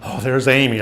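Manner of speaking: ordinary delivery, nothing marked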